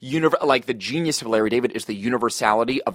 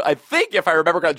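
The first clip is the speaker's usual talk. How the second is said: usual speaking voice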